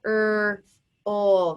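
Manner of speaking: slow separate sounds, k, er, ol; sounded out. Shown as a demonstration. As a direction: say "K-er-ol" as isolated sounds for spelling. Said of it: "Let's write it".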